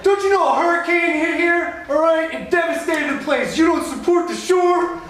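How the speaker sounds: high, loud indignant attendant voice; angry parking guy. Retold or imitated. Parking attendant guilting the comic, high and loud. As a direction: bark it like an outraged lot attendant. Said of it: "He's like".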